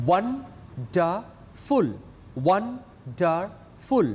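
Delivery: "Wonderful" very slowly as won... der... ful; "wonderful" broken into won-der-ful with long gaps; split into syllables. Shown as a demonstration. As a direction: sound out "wonderful" in three separate beats, twice. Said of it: "three syllables".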